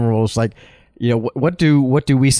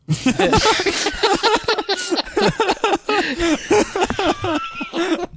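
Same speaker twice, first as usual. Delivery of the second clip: high mocking laugh. Mocking laugh after punchline.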